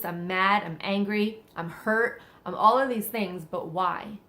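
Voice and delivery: ordinary talk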